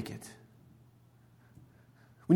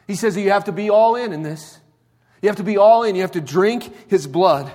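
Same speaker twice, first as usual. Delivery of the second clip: louder, higher, driving it home; preaching at full force; 5 semitones higher, 5 dB louder than usual. Real, not acted. Pastor urging the congregation to go all in, louder and higher. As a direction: push hard on all in.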